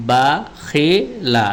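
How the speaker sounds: বাখিলা drawn out slowly, syllable by syllable; slow separated reading. Shown as a demonstration. Teacher reading বাখিলা slowly for students before joining it. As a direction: ease through বাখিলা slowly, pulling the syllables apart. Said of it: "এক দুইবার এভাবে পড়ে".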